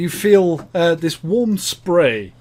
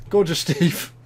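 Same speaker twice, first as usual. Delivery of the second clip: usual speaking voice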